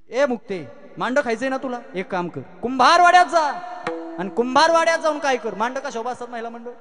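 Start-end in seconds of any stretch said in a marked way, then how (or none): 0.1-5.5 raised, louder brotherly instruction; lightly voiced elder brother. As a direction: send little sister off on an errand, lightly acted. Acts brother instructing little sister. (मोठ्या भावाचा किंचित चढा आवाज)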